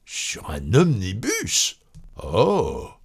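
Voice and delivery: slow, drawn-out disbelieving question; incredulous cousin. A narrator's reading. Cousin repeating the wife's answer, incredulous. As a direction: echo it slowly in disbelief.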